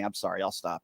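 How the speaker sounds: ordinary talk, nothing marked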